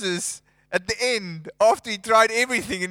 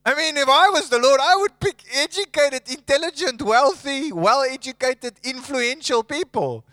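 incredulous; 7 semitones higher, 5 dB louder than usual. Real, not acted. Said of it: "preacher's incredulous riff".

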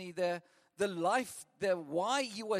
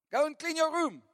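higher, louder parent command; mock parent yell; 9 semitones higher, 7 dB louder than usual. Fed-up parent orders the spoiled child, loud mock yell.

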